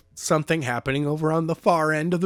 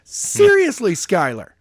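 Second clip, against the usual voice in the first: high, loud exasperated "God, seriously"; exasperated mock cry; 10 semitones higher, 4 dB louder than usual. Friend mocking the character's exasperated line, high and loud. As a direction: squeak it out in disbelief. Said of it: "You gotta go one more notch higher".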